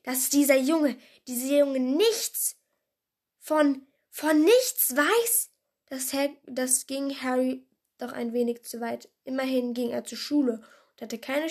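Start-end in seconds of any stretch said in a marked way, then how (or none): 0.0-5.5 loud, slow outraged disbelief; halting, incredulous anger; growling indignation. A narrator's reading. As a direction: draw out the outrage, rising at the end. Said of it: "knurrte er sie an".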